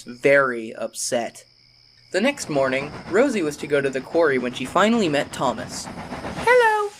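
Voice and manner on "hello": very high, bright little engine hello. A narrator's reading. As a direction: greet him sweetly and eagerly. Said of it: "she greeted the little blue engine".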